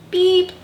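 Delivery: high, loud toot; truck horn beep. A narrator's reading. Blue answers the goat, high beep. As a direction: toot back like a little truck. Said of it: "Blue said".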